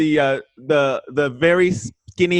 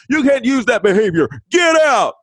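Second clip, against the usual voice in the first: sharp, rising shout of an order, much higher and louder at the end; mock angry administrator yelling at him; retold yell; 8 semitones higher, 4 dB louder than usual. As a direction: bark him out of the room, voice jumping up on get out.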